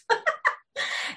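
brief high, breathy chuckle; short laugh. Real, not acted. Guest relieved the sound finally works, short laugh. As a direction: let out a quick little laugh.

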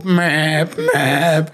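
beeping like an alarm clock; alarm beep imitation. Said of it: "klingelt um acht Uhr dein Wecker so".

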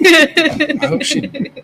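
high, slow laughing words. Real, not acted. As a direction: say it through a laugh. Laughing about [name].

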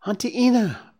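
high, joyful cry of her name; joyful exclamation. Acted. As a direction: call out to her, thrilled. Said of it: "Bambi exclaimed".

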